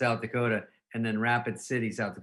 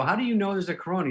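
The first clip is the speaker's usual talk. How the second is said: fast, pointed, a touch higher; quick mocking challenge; 8 semitones higher, 4 dB louder, faster than usual. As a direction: needle him with a quick question. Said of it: "I go".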